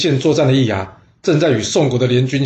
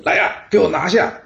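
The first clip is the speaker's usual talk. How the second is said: 高声喝令拿下; 厉声下令，命人拿人; 大喝一声; 7 semitones higher than usual. A narrator's reading. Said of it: "大喝一声". (大喝一声下令)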